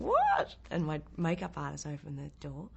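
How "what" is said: very high, loud shocked what; startled what. Retold or imitated. Actress startled by banging on her trailer door. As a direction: jump at the knocking. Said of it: "I'm going".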